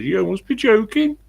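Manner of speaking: high, loud Brummie lilt rising at the end; Birmingham accent. Retold or imitated. Woman answering praise of her accent, Birmingham accent. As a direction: imitate a Brummie, voice climbing high. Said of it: "The voice rises".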